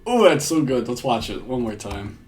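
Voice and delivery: usual speaking voice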